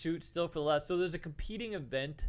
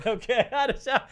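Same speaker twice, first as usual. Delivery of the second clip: quick, loud, laughing; 7 semitones higher, 8 dB louder, faster than usual. Real, not acted. Telling co-host about the dealer, laughing loudly. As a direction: laugh through your own joke.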